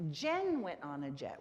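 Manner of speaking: emphasize Jen to show who went; stress on Jen. Shown as a demonstration. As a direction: lean hard on the first word, Jen. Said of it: "the who is what we're emphasizing".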